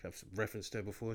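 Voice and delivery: normal talking voice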